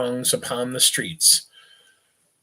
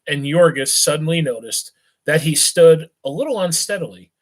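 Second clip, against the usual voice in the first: usual speaking voice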